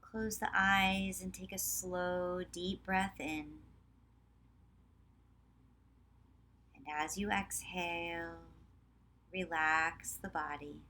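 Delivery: measured meditation voice with long pauses; guided meditation. Real, not acted. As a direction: guide them calmly, leaving space after each phrase. Leads a guided relaxation practice.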